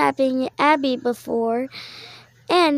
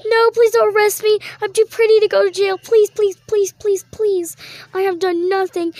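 high, loud, fast begging; pleading prisoner; 4 semitones higher, 3 dB louder than usual. Pretend. Accused girl begs the police not to jail her, frantic pleading. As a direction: beg like you're being dragged off.

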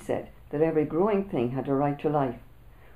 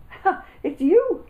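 laughing; 9 dB louder, slower than usual. A narrator's reading. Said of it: "I laughed".

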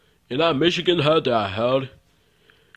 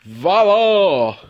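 loud, high showman's voila; ta-da like a stage magician; magic reveal; 7 semitones higher, 10 dB louder than usual. Pretend. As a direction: announce the trick with a flourish. Magician reveals rabbit from hat.

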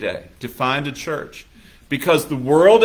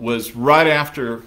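ordinary delivery

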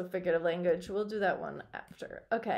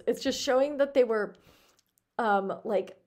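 ordinary delivery, nothing marked

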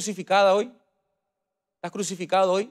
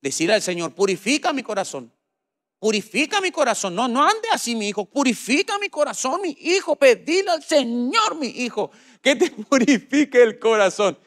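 high nasal pleading grandma, climbing higher; grandmother's nasal voice; 7 semitones higher than usual. Retold or imitated. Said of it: "Con su voz nasal, mi abuela me decía".

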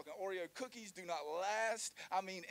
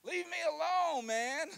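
high yelled plea, much louder; shouting; 8 semitones higher, 10 dB louder, much slower than usual. Pretend. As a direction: yell it to get away. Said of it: "I'm gonna say".

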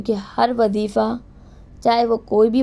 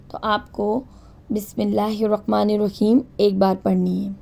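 ordinary delivery

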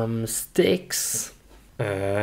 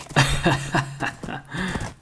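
breathy giggle; 5 semitones higher, 5 dB quieter than usual. Real, not acted.

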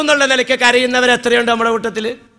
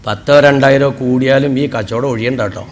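much lower, coaxing broker voice; cattle broker; 13 semitones lower than usual. Retold or imitated. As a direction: talk the buyer into the deal, low. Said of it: "അപ്പോൾ broker പറയേ".

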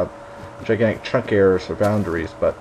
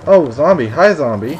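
mock loud greeting; 4 semitones higher, 7 dB louder than usual. Greeting a zombie mockingly.

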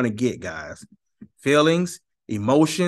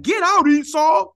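a higher, louder mock yell of get out; mock shout; 7 dB louder than usual. Congregation driving Strong away, hammed-up shout. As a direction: throw him out with a hammed-up shout. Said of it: "Y'all be looking at him like".

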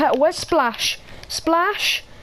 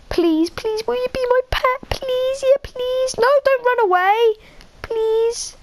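begging a pet; 12 semitones higher than usual. Real, not acted. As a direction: coax the dog not to run away.

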